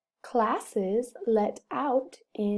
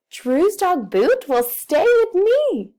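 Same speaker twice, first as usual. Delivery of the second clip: bright, much higher and louder happy read; 8 semitones higher, 7 dB louder than usual. Shown as a demonstration. Teacher showing class a happy reading voice. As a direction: read it like the best news ever. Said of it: "I want to sound happy".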